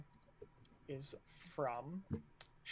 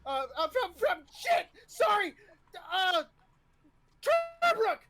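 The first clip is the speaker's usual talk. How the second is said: very high, loud, panicky fumbling; nervous screamed stammer; 8 dB louder than usual. Acted. Nervous cadet forgetting his answer before the officer, screaming. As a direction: blurt it out screaming, stumbling over it. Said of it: "almost as if he's too excited".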